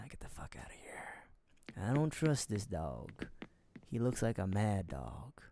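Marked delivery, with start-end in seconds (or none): 0.1-1.8 slow, hushed whisper; urgent whisper. Real, not acted. Urges chat to flee the house, whispering. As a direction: creep out quietly. Wants to leave the murder house.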